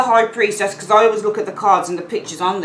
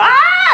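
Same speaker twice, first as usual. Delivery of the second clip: very high, loud comic shriek; mock panicked shriek; 8 dB louder than usual. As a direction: shriek like a bug is buzzing round you. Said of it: "This one's like".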